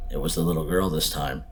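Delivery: ordinary delivery, nothing marked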